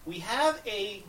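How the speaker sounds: normal talking voice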